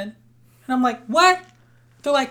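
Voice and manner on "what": high, loud, rising "what?"; retold disbelief, pitched up sharply; startled what. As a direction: blurt it back as a baffled question. Retells being put on the spot.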